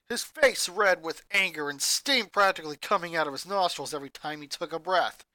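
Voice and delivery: plain reading voice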